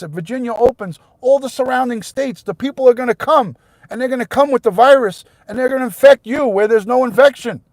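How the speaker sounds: loud, high, furious rant; real anger. Real, not acted. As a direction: shout it at the people who won't listen. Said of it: "I'm just getting so angry right now".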